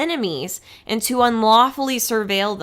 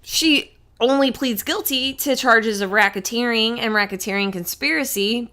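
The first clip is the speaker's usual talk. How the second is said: ordinary talk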